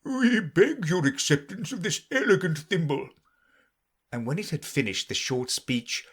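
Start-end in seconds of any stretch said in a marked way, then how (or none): 0.0-3.1 higher, solemn formal Dodo voice. A narrator's reading. Dodo solemnly presenting the thimble, formal. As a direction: present it with grave ceremony. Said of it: "the Dodo solemnly presented".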